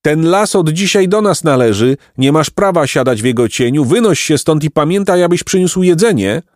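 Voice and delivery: calling out. Acted. Placek calling out to chase the old man away. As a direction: order him off the land. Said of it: "Wtedy Placek zawołał".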